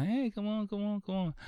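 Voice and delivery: put-on high-pitched pestering street kids; goading kids. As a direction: wheedle like a kid begging. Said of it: "they just keep goading them".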